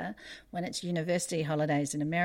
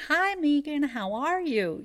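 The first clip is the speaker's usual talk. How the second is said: put-on bright American accent, pitched much higher and louder; imitated American accent; 10 semitones higher, 5 dB louder than usual. Mother mimicking her daughter's friend with American accent. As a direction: chirp it like an American teen. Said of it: "with these American accents".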